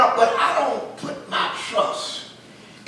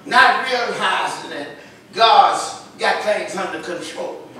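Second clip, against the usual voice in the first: normal talking voice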